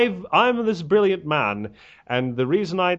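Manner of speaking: a weak, slowed take on a pompous intellectual; Christopher Hitchens impression. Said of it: "imitate it sort of Hitchin style, and it's very bad".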